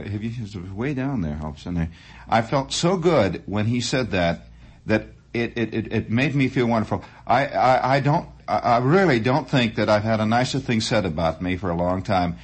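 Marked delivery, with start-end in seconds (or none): none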